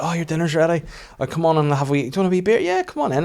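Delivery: high, eager welcome; welcoming housemate. Retold or imitated. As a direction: usher them in brightly, pitch climbing.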